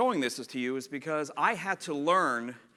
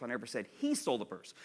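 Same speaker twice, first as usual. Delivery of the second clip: he lifted out of a lower-pitched line; emphasize he as the contrasted word; stress on he; 4 semitones lower, 5 dB quieter than usual. Shown as a demonstration. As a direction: point up the word he. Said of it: "they have six different meanings".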